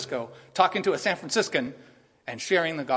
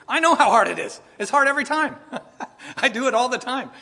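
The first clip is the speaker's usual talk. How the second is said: laughing it off, high and loud; rueful laugh; 9 semitones higher, 8 dB louder than usual. Real, not acted. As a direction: chuckle through the admission. Laughing at himself.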